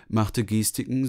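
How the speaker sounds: ordinary delivery, nothing marked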